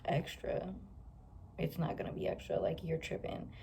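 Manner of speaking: unvoiced disbelieving whisper; whispered inner thought. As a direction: whisper it to yourself, scoffing. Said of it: "I'm just like".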